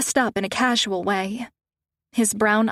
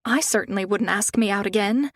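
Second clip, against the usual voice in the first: plain reading voice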